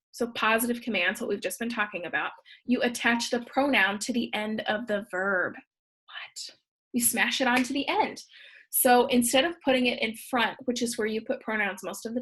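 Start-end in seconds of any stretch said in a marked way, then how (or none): 5.5-6.6 barely whispered, incredulous aside; whispered mock disbelief. Teacher reacting to her own grammar rule, whispered disbelief.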